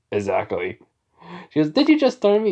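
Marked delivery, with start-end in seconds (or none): 1.7-2.5 high, louder mock-indignant challenge; affronted mom. Mom confronts son after his joking threat, affronted. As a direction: act out a mother taken aback.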